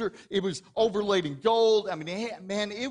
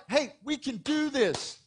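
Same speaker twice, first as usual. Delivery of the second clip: higher, slower rallying cry; pump-up coach; 9 semitones higher, slower than usual. Retold or imitated. Pastor imitates a coach rallying his losing team, upbeat. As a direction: fire the team up, a touch hammed. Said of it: "Man, you wanna pump 'em up".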